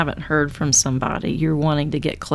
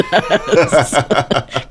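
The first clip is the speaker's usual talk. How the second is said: real laugh; 3 dB louder than usual. Real, not acted. Host amused at her plan to test it, real laugh. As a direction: open burst of laughter.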